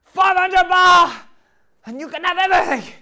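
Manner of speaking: piercingly high, very loud shriek; hammed-up screech; Thai prostitute's price call. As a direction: scream out the offer in a put-on voice. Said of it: "She said".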